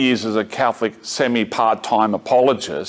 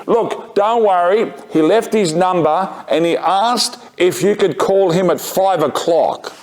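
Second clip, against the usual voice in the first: high, slowed mimed call; 7 semitones higher than usual. Retold or imitated. Office worker passing on a message from the window, mimed calling out. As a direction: relay a message across a distance, pitch held up.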